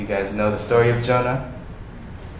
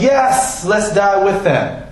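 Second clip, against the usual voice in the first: eager Thomas; 8 semitones higher, slower than usual. Retold or imitated. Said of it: "Thomas goes".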